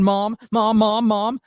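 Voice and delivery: high, mock-panicked cries for Mom; retold screaming. Kid running inside to mom about the fire, retold screaming. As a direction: imitate a frightened kid calling out, short of a full scream. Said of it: "like, run inside screaming".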